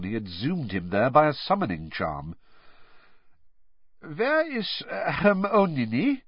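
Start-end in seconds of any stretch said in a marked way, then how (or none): 4.0-6.2 slow, hesitant foreign-sounding Krum; halting, searching for words; Krum's voice. A narrator's reading. Krum asking Ron for his date, halting.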